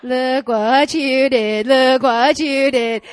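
slow, drawn-out loud accusation; taunting devil. Retold or imitated. Devil accusing her after she cursed, slow mock taunt. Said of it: "I hear the devil going".

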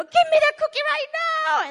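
put-on high, loud toddler screech for the cookie; preacher voicing a toddler screaming for a cookie, high and loud. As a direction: scream it like a child who won't wait. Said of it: "scream for it".

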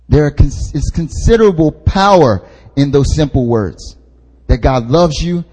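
ordinary talk

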